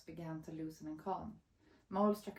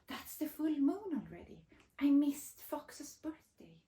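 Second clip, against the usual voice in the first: dismayed realisation; 7 semitones higher, 3 dB louder than usual. Acted. Mole realises forgotten birthday.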